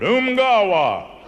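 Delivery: slow, drawn-out umgawa with a laugh; Tarzan call. Retold or imitated. Comic telling Tarzan's favorite word, drawn out slowly. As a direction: stretch out umgawa like a jungle call. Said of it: "Tarzan's favorite word".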